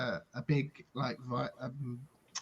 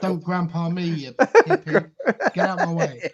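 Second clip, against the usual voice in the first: put-on old man yelling, laughing through it; angry old man; 5 semitones higher, 14 dB louder than usual. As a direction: bark at him like a cranky grandpa. Old man NPC shouting back.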